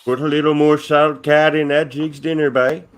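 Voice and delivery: jolly Newfoundland host; mock Newfoundland accent. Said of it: "Oh, there you go".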